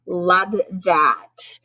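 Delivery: plain reading voice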